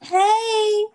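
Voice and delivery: loud, high, bright intro hey; excited greeting. Real, not acted. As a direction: shout the greeting with energy.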